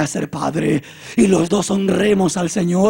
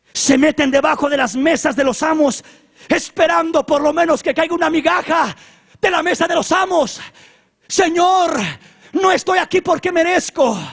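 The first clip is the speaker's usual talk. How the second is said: high, loud insistent plea; raised, fervent humble appeal; impassioned pleading; 10 semitones higher, 5 dB louder than usual. Acted. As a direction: plead at full voice. Acted woman pleading her case to Jesus.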